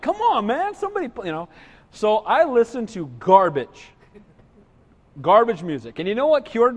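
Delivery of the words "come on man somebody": high, pleading cry for someone. Retold or imitated. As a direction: beg with the voice jumping up. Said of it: "And I'm like".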